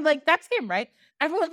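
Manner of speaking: plain reading voice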